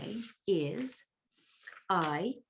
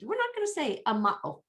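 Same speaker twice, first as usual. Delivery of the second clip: high-pitched put-on protest; mock refusal; 7 semitones higher, faster than usual. Teacher voicing those who dropped the A, high mock refusal. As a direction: refuse in a silly high voice, easing down. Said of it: "They just said".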